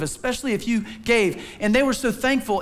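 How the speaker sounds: ordinary talk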